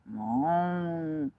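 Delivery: loud drawn-out cow moo; cow impression. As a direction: moo it out like a cow across the field. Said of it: "I'm fixing to do my cow impression".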